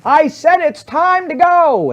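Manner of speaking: slow, loud, high-pitched yell; yelling wife. Acted. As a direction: yell it word by word to a spouse who didn't hear. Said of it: "now she's yelling at you".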